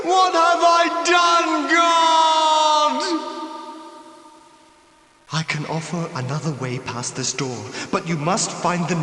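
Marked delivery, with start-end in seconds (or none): 0.0-3.3 slow, much louder and higher shout to heaven; anguished cry. Acted. Wronged ghost crying out to God, anguished shout. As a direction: howl it at the sky.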